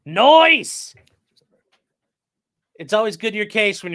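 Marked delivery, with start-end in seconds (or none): 0.1-0.9 Nice drawled as noice, pitched high and loud; loud, high, drawn-out noice; exaggerated high Nice. Shown as a demonstration.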